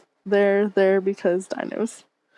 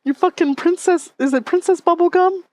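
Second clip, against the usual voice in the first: mock outrage; 8 semitones higher, 4 dB louder than usual. Real, not acted.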